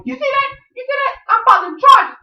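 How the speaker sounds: very high mock protest, ending loud; indignant screech. [name] threatens to file charges, high mock screeching. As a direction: squeal it like an outraged victim.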